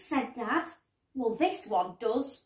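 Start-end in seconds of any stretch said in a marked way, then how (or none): none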